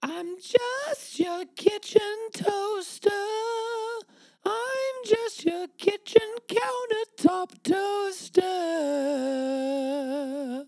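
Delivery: high pleading. Acted. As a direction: beg like a hurt toaster. Toaster pleading.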